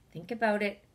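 normal talking voice

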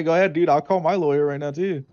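ordinary talk, nothing marked

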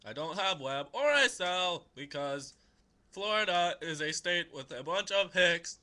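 loud mock recital; hammed-up, emphatic reading voice; funny letter reading. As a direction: proclaim it as if still reading the letter. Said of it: "I read them a funny way".

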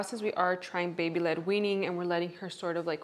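normal talking voice

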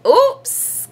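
high, sudden cry of dismay; high-pitched little exclamation; crying out oops. A narrator's reading. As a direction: squeal it like a caught-out kid. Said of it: "cried Froggy".

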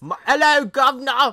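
very high, loud mock-British; terrible British accent. Showing how bad it is, shouted British accent. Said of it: "my British accent's the worst".

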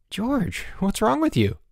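high-pitched mock alarm; alarmed cry. A narrator's reading. Boots to his freshly spotted roommate, crying out alarmed. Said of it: "Boots cried".

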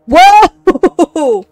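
big, high, loud shout of surprise; startled whoa. Real, not acted. Player startled by the creepy eyes, loud whoa. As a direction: jump at the scare. Sudden scare in game.

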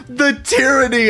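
high, drawn-out theatrical wail; hammed-up high adoring cry; mock dramatic wail. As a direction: camp it up, high and slow like a swooning fan. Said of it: "everybody just goes".

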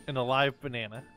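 ordinary delivery, nothing marked

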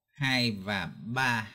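"hai và ba" slowly counted, each syllable on its beat; rhythm count. Shown as a demonstration. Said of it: "chúng ta phải đọc là". (đếm chậm "hai và ba" theo phách)

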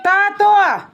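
loud, high call of "Тато"; mother shows daughter a loud, high call; calling for dad. Shown as a demonstration. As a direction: show the child how to shout for dad. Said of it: "Кричи".

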